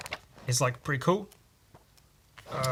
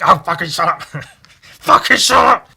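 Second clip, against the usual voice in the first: loud mock outburst, pitched up, building; exasperated shout; 5 semitones higher, 12 dB louder than usual. Friend fed up with the running joke, exasperated mock shouting. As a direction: yell it in hammed-up exasperation, louder the second time.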